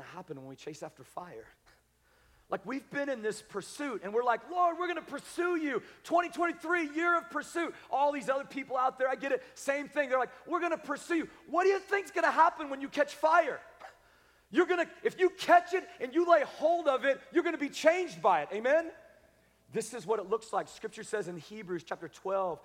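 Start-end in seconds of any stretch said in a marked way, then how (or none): 4.5-7.7 high-pitched, zealous declaration; eager pledge. Acted. Believers pledging pursuit to the Lord, eager. 10.5-11.3 eager pledge. Acted. Mimics others' eager pledge. 11.5-18.9 high, loud challenge; forceful preaching. Real, not acted. Preacher challenging those who chase fire, forceful.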